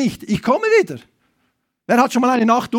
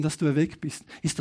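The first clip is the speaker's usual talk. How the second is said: usual speaking voice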